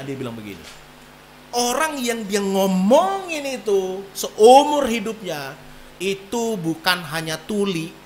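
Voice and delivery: normal talking voice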